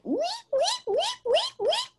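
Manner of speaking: very high squeaky weet weet; guinea pig noise. Retold or imitated. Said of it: "they'd start making the guinea pig noise".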